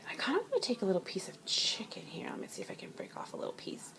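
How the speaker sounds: soft hushed whisper. Real, not acted. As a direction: whisper it close to the mic. Breaking off chicken.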